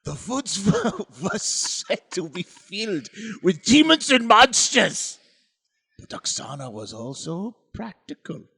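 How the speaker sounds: hushed Eastern European storyteller, swelling on scary bits; mock Ukrainian accent. Resuming the folk tale after being scolded, mock Ukrainian accent. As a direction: tell it spookily in a bad Ukrainian accent. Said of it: "you're not doing the accent".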